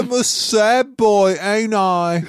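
loud, high Cockney caricature; silly high-pitched Cockney. Acted. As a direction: belt it in a hammed-up Cockney accent. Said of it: "He's a Cockney apparently now".

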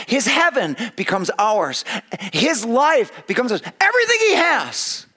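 high, loud, slowed, building sermon read; fervent preaching. Acted. Preacher reading Spurgeon's sermon aloud, fervent and rising. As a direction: proclaim it with mounting fervour.

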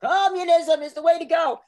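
put-on loud, high-pitched old socialist preaching; hammed-up elderly comrade declaring it; old socialist teacher. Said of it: "I'm getting hit with".

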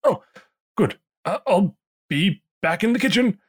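high, flustered call from the kitchen; flustered innkeeper. Acted.

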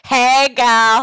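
loud, high-pitched drawn-out greeting; hyped greeting. Pretend. Guest echoes host's hello at the top, loud playful holler.

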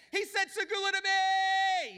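very high, loud and slow; giddy squeal. Pretend. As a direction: shriek it like a thrilled girlfriend. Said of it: "and says".

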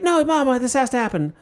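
high protest to mama, easing down; imitating a fiancee pleading with her mom; pleading daughter. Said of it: "Lindley's like".

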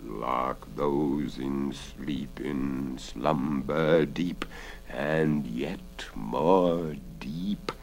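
very slow, low incantation; drawn-out, deep, hushed spellcasting; robber's spell. A narrator's reading. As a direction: chant a sinister sleep spell softly. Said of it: "she remembered the robber's spell".

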